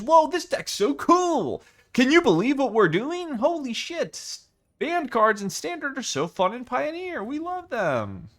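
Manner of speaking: high, loud, hyped-up YouTuber energy. Pretend. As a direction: gush like a clickbait video host. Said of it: "That's my, that's my YouTuber voice".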